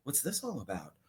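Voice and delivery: hushed, awed mock crowd murmur. Describing the crowd watching Wonka, a hushed awed murmur. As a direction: drop way down to a hushed murmur of wonder. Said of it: "everybody's like".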